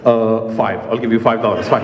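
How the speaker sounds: low, drawn bargaining dad. Retold or imitated.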